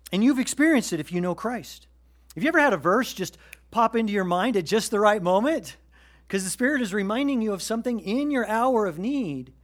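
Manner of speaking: rising pulpit intensity; preaching at full force. Acted. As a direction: preach it out to the room with fire.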